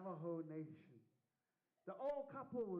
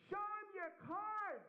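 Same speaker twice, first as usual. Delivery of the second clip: mock yell; 12 semitones higher, 3 dB louder than usual. Rancher yelling punchline at official.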